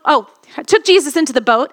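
usual speaking voice

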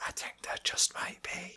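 hushed and knowing; conspiratorial whisper. Real, not acted. Co-host joining the spoiler tease, conspiratorial whisper. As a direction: whisper it in on the secret.

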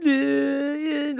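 high, loud, drawn-out mock babble; pretended incoherence. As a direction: imitate a wordless drawn-out whine.

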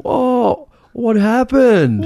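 put-on very high, slow, squeaky old-lady voice; mock old lady. Mocking the old lady asking about the duck, slow and squeaky.

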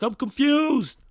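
high, loud panicky cry of confusion; mock panic in a high, loud voice; panicked whine. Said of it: "I'm like".

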